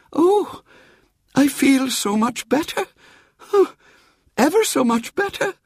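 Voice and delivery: put-on high, sighing old lady voice; lightly voiced old aunt. Great aunt to the nurse, a hint of a frail, sighing old lady. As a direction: play the frail old aunt, a little hammed-up.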